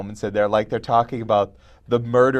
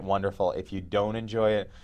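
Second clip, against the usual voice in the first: ordinary talk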